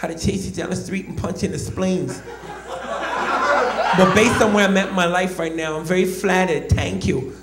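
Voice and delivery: a hint of Tyson's threatening calm; Tyson's reply. Tyson answering the comedian, lightly voiced Tyson. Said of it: "he finally said".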